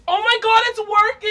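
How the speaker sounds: high, loud amazed squeal; thrilled disbelief; excited. Real, not acted. Mirror forming.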